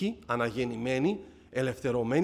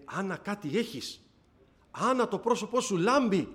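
astonished; 6 semitones higher than usual. Retold or imitated. Mother sees Anna's face glowing.

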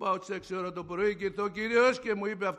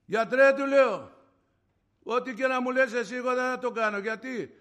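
loud, high, emphatic declaration; raised-voice refusal, acted out; retold defiant refusal; 8 semitones higher, 10 dB louder than usual. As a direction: tell the doctor no at full voice.

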